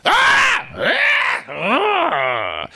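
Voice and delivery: hammed-up wordless shouts; mock fighting yells. Shouter sparring with his new partner, yelling. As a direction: bellow back and forth like squabblers. Imitating two shouters going at it.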